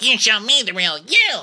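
high mock-voice comeback; high squeaky mock voice. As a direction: squeal it back like a mocking echo. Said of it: "so she's like".

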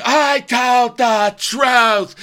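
very high, slow, loud shriek; drawn-out mock scream. Imitating Lisa screaming over Angie, long high mock scream. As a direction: scream it hammed-up, stretching every word. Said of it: "when she's screaming".

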